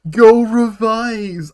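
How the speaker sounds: loud, high, drawn-out command; playful loud order. Real, not acted. Streamer ordering a viewer to go revise, playful loud command. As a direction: send them off in a long, high holler.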